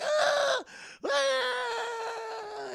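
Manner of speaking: very high, drawn-out shriek; imitated hysterical wail; mock panicked scream. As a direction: scream as if freaking out, played for comedy. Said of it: "is fucking freaking out".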